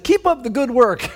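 high, loud mock congratulation; imitating oblivious guest. Oblivious guests greeting the president.

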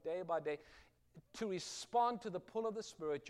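ordinary talk

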